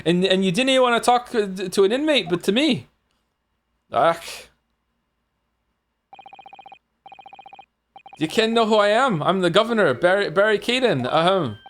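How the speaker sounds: put-on amateur Scottish accent for a self-important governor; attempted Scottish accent. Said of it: "I can't do a Scottish accent".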